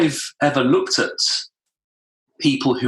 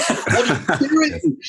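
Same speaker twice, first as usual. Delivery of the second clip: high, slow, laughing "What are you doing?"; "What are you doing?" high and amused, falling on "doing"; laughing take; slower than usual. Shown as a demonstration. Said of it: "depending on where you put the intonation in".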